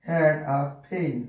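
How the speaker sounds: very slow, each word drawn out for writing; slow dictation. Shown as a demonstration. Teacher reads the example to students, slowly. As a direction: dictate they had a fan slowly. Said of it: "dictating example sentence".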